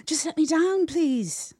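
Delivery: high, loud desperate begging; pleading. A narrator's reading. Trapped bully begging the hidden spider, pleading. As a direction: beg loudly to be freed. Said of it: "Master Muffet pleaded".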